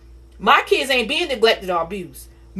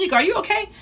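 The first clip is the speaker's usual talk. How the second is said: higher, gentle worried question; concerned teacher; 11 semitones higher, much faster than usual. Retold or imitated. Teacher asking the crying student if she's okay, higher. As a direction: check on the upset student. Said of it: "She said".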